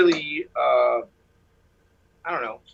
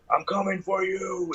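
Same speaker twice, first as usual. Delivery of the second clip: slow, softer spooky threat with stretched words; menacing entity voice; 3 semitones higher, 5 dB quieter, slower than usual. Retold or imitated. Caller mimics the voice threatening him, slow eerie menace. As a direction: imitate a ghoul, drawing out the threat. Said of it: "that thing starts laughing".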